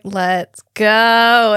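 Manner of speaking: loud, long drawn-out GO; hammed-up impatient call; impatient urging. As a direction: stretch the last word in mock exasperation. Retold impatient urging to leave.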